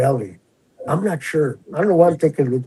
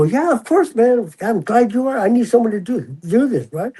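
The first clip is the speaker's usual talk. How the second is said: much higher, overly eager agreement; fake friendly; 7 semitones higher than usual. Officer pretends to trust the inmate, fake cheerful. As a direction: play it chummy to fool him.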